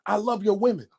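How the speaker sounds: usual speaking voice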